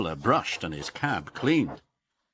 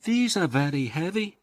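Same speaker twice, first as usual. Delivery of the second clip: puffing Emily; 9 semitones higher than usual. Acted. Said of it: "she puffed".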